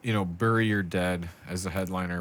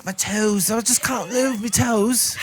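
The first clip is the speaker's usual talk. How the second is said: higher, slower hammed-up lament; freezing peasant; 11 semitones higher, slower than usual. Freezing worker pleading with the boss, slow hammed-up moan. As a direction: moan about frozen toes.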